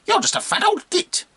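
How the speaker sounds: high-pitched cheeky monkey voice; scoffing monkey. Acted. Monkey talking back to the bishop, high and scornful.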